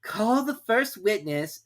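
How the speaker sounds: a pompous king giving orders; King ordering the herald to read; King's voice. A narrator's reading. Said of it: "said the king".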